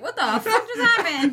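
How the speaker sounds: high, baffled and laughing; amused, incredulous outburst; laughing disbelief. Retold or imitated. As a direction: replay your shock through laughter. Retelling own baffled reaction, laughing.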